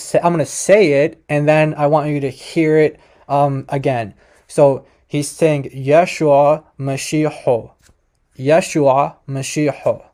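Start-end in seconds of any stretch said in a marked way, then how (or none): 5.9-10.0 slow, careful "Yeshua Mashiach" with O vowels, ending "-ho"; Western Syriac pronunciation. Shown as a demonstration. Teacher showing viewers the Western dialect, slow demonstration of "Yeshua Mashiach" ending in "-ho". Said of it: "That's in the Western dialect".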